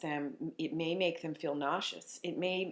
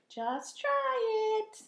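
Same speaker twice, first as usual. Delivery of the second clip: put-on high, very slow insisting child; imitating daughter; much slower than usual. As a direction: drag it out like a small girl. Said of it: "she's like".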